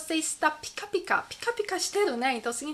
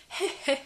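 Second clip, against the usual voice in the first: short put-on chuckle; light, deliberate little laugh; demonstrated "heh" laugh; 4 semitones higher than usual. Shown as a demonstration. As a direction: give a brief heh-heh. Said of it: "Então você pode rir".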